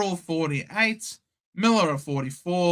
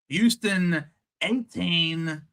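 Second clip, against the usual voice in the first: usual speaking voice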